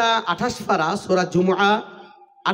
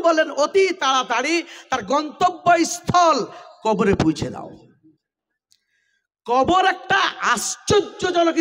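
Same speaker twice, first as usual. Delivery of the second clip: fiery preaching; 6 semitones higher than usual. Acted. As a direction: preach it with rising fervor. Preacher raising voice, full force. (জোরালো ওয়াজের সুর)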